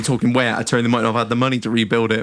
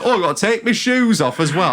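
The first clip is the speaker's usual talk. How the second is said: higher, put-upon Yorkshire whinge; Yorkshire accent; 6 semitones higher than usual. Acted. Passenger at security moaning about shoes, in a Yorkshire accent. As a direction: grumble like an entitled Yorkshire bloke. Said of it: "I'm giving him a Yorkshire accent".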